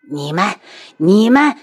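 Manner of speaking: loud, choked rage; too angry to finish; speechless fury. Acted. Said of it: "气得说不出话来". (气得说不出话)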